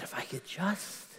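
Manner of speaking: hushed, breath-only whisper; whispered inner resolve. Acted. Bleeding woman telling herself she can touch his garment, whispered. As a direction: whisper it like a private thought. Woman's private thought before the miracle.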